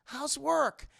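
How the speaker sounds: put-on high, cheery kid voice; bright little son greeting his dad; toy Max voice. Toy head speaking to Goofy as Max, high and cheery. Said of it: "and it goes".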